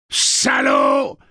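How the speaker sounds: high, full-force bellow; raging high yell; enraged robot shout. A narrator's reading. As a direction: explode in an unrecognisable robot rage. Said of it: "Cette voix n'avait rien de commun".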